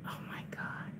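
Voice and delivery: breathless whispered oh my God; hushed frustrated whisper; whispered exasperation. Real, not acted. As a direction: whisper it in disbelief. Camera keeps failing to focus.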